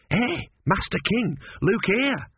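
high-pitched eager lad; young apprentice. A narrator's reading. Apprentice calls the king to the wall, high and eager. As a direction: call out high and keen. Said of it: "said Gurn".

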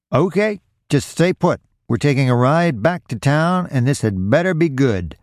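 high-pitched, stern warning; threatening order. A narrator's reading. Robbed man orders Roy back to town, threatening. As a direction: warn them, starting high and easing down.

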